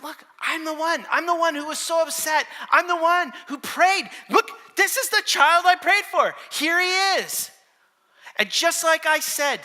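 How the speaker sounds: high, loud, emphatic outburst; excited insistence. Acted. Hannah shows the priest the child she prayed for, loud and excited. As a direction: press each claim on him at full voice.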